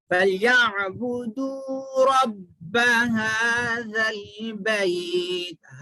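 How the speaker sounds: slow drawn-out melodic chant; Quranic recitation. Shown as a demonstration. Teacher recites to students, slow tajweed chant. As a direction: recite it slowly with long held vowels.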